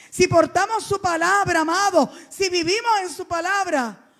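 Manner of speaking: loud, high, impassioned sermon delivery; full-force preaching. Acted. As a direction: preach it out to the whole church. Preaching to the congregation.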